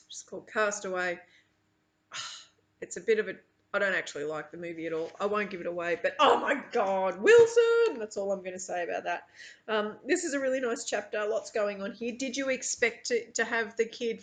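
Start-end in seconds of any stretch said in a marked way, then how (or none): 6.2-8.0 mock anguished cry. Castaway quote, mock cry.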